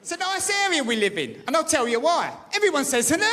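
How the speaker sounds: ordinary talk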